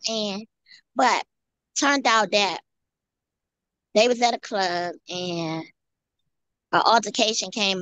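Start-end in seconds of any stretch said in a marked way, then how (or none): none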